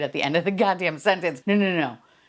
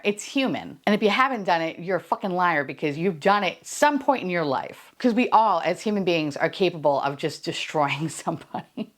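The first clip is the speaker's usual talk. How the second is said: usual speaking voice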